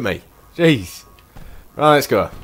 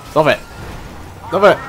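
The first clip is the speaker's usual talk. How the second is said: urgent yelling; 5 semitones higher than usual. Real, not acted. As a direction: bark it at the truck. Repeatedly yelling at the truck.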